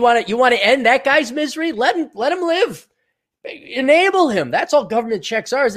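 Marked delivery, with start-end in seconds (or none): 1.7-4.5 sarcastic high taunt. Acted. Sarcastic revenge rant.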